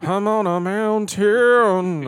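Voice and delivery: high, loud, bleating rock singer; goat voice. Pretend. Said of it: "goat voice".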